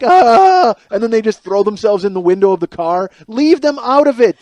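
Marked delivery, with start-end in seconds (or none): 0.0-0.7 short high yelp, louder and higher; mock scream. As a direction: yelp like someone startled by a lunge at the car. Acted fright at car window.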